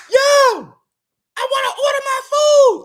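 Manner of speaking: very high, loud demanding shout; young customers yelling. Acted. Young boys demanding food at the store, high-pitched yelling. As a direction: holler at the counter like an impatient customer. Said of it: "young boys come in".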